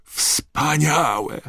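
high-pitched, thrilled exclamation; excited cry. A narrator's reading. Ron marvelling at the golden shamrock, excited cry. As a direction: cry out in delight, pitch leaping up. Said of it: "krzyknął Ron".

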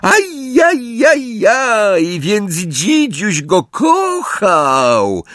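high, very drawn-out wail of fake pity; slow, high, jeering singsong; mocking baby talk. A narrator's reading. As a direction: taunt as if cooing at a baby. Villain mocks Harry in baby talk.

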